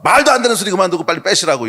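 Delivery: half-acted angry demand; mock outburst. Anyone shouting back at the rude car owner. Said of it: "이거 버럭하는".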